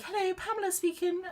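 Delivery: high, prim phone greeting, mock posh; posh grandma. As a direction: imitate a posh old lady answering the phone. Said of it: "that's how she talks, like, very posh".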